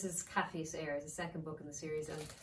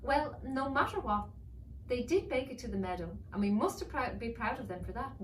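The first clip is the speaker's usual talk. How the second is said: higher, lighter motherly voice; mother dragon; 6 semitones higher, 4 dB louder than usual. Acted. Mother dragon reassuring her husband, higher and lighter. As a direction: soothe him as a gentle mother dragon.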